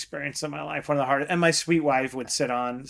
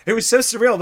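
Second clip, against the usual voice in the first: excited; 9 semitones higher, 8 dB louder than usual. Real, not acted. Excited recalling the first pitch.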